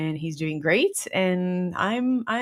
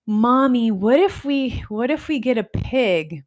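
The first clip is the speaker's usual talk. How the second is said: daughter; 5 semitones higher, 5 dB louder than usual. Retold or imitated. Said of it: "my daughter was like".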